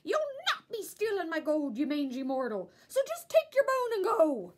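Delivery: angry little leprechaun yelling. A narrator's reading. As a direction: drive the intruder off with a roar. Angry leprechaun scolding dog.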